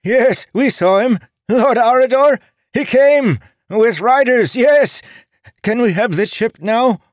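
very high, loud beggar wail. A narrator's reading. Three beggars wailing to keep the chip. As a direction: wail it out desperately. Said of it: "they wailed as one".

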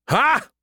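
short, very high snort of a laugh. A narrator's reading. Skippy amused by Joe's DMV joke, snorted laugh. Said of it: "he snorted".

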